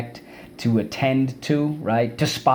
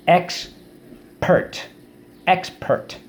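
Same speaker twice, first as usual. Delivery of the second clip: Expert said higher and drawn out; model word; 7 semitones higher, much slower than usual. Shown as a demonstration. Teacher models the word high for students to repeat. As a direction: lift Expert high, stress EX. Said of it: "So let's go".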